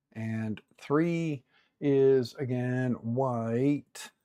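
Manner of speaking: slow, spelled-out listing; slow and deliberate. Real, not acted. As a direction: read each slot out slowly. Slowly reading out color slots.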